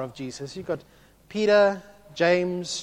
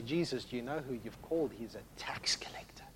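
hushed aside; 5 semitones lower, 10 dB quieter than usual. Retold or imitated. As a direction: drop to a lower, soft whisper at the end. Disciples muttering aside to Jesus.

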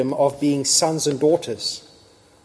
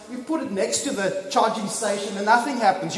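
normal talking voice

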